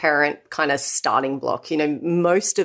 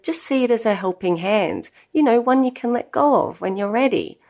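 put-on kindly Cockney-accented advice, rising higher toward the end; 6 semitones higher than usual. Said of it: "had this lovely Cockney accent".

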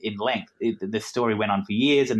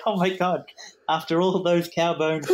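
imitating a teasing mother, pitched higher; higher-pitched mock disbelief, drawn out at the end; 7 semitones higher than usual. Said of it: "She was just mocking me".

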